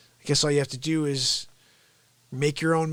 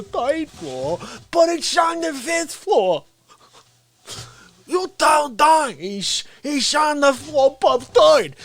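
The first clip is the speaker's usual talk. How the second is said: ranting character voice; 7 semitones higher than usual. Acted.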